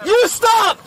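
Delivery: yelling in real panic; furious shout, fighting back; angry shouting. Real, not acted. Struggling against arrest.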